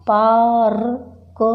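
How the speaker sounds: पार्क drawn out very slowly, r then k; a slow, spelled-out पार्क; slow pronunciation demo. Shown as a demonstration. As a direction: stretch पार्क out for the class. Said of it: "जैसे पार्क".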